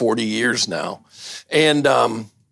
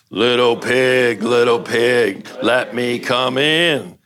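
slow, drawn-out sing-song wolf call; coaxing wolf; slower than usual. Acted. Wolf coaxing the second pig at his door, slow and drawn out. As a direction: lure the pig out slowly and sweetly.

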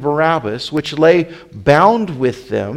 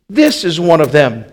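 pointed, louder accusation; 3 semitones higher, 7 dB louder than usual. A narrator's reading. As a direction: call out the accused to bystanders. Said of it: "began to say to them that stood by".

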